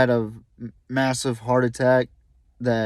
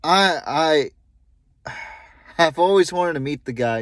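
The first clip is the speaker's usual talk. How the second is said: halting, with a heavy sigh; real emotion, stumbling on the first word; choked start, sighing before going on; a little emotional; 3 semitones higher than usual. Real, not acted. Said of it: "getting a little emotional right now".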